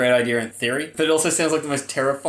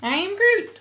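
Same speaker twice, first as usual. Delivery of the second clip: high squeaky Groot. Retold or imitated. Mimicking tiny Groot's one line, high and squeaky.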